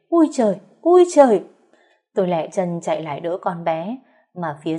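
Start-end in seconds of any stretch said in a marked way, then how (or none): 0.1-0.6 sharp, high alarmed yelp; startled cry. A narrator's reading. Chị Thắm jumps back from the falling teapot, startled cry. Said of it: "hốt hoảng kêu lên". 0.8-1.4 much louder, higher frightened shriek; panicked shriek. A narrator's reading. Chị Thắm panics as the teapot shatters, loud shriek. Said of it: "hốt hoảng kêu lên".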